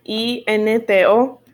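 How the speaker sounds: ordinary delivery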